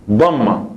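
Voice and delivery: dhamma said the Arabic way, dom-ma; Arabic word dhamma. Shown as a demonstration. Teacher naming the Arabic vowel, careful dhamma. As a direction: say dhamma with a full double m. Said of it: "Se escribe así".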